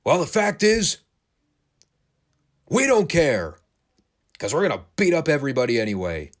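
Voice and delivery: hammed-up brawler boasting into the camera; Animal's wrestling promo. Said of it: "turns back to the camera and goes".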